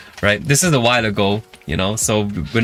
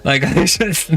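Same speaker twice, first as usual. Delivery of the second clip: laughing; 5 semitones higher, slower than usual. Real, not acted. Laughing at own joke.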